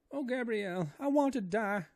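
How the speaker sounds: high, loud wailing maid voice; terrified woman begging to die, high wailing; crying Esmeralda. A narrator's reading. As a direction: wail in despair with eyes shut. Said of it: "cried the poor woman".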